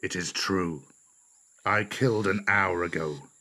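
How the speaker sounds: cool. A narrator's reading. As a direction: admit it coolly, without concern. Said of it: "said Shere Khan coolly".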